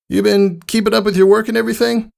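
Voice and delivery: high, hesitant tomboy pony; clumsily checking in; unsure Rainbow Dash. A narrator's reading. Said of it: "that unsure smile".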